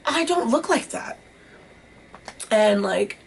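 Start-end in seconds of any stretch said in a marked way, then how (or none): 0.1-1.2 high, wide-eyed disbelief; shocked to see how she looked; stunned realization. Retold or imitated. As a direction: gasp it out as the truth lands. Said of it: "I was like".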